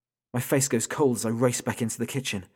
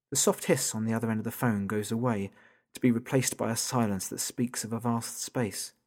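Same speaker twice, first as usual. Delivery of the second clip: ordinary delivery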